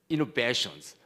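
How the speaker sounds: ordinary talk, nothing marked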